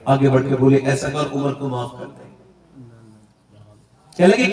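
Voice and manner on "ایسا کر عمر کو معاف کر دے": low, soft asking for pardon; humble soft plea. Acted. Umar to the widow, softly begging forgiveness. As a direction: plead gently, hiding tears.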